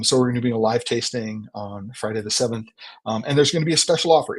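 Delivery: usual speaking voice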